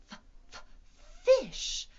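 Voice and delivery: f- unvoiced and hushed, then fish high and clear; f sound drill. Shown as a demonstration. Teacher drilling F with kids, hushed f- then fish. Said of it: "try it with me".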